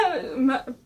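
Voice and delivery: normal talking voice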